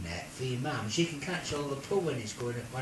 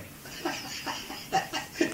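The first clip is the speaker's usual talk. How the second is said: laughing; 12 semitones higher than usual. Real, not acted. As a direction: burst of real laughter. Teller cracks up at own story.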